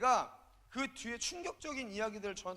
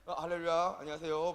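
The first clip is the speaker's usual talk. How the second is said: lightly pious greeting, a bit louder; mild reverent hallelujah; holy phone greeting; 4 semitones lower, 5 dB louder than usual. Shown as a demonstration. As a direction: greet the caller like a devout pastor. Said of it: "굉장히 성스럽게 전화를 받아요".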